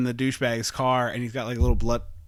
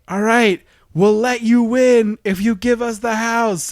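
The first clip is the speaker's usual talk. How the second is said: calling out; 8 semitones higher, 7 dB louder than usual. Retold or imitated. Mock negotiation shout.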